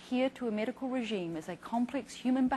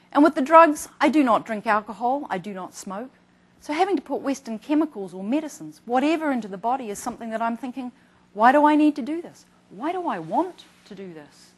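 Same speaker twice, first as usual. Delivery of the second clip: quoting a patient; 3 semitones higher, 11 dB louder than usual. Retold or imitated. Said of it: "some of the comments".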